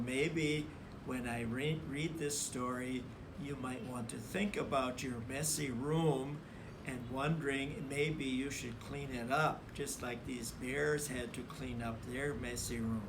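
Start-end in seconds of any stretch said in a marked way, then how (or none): none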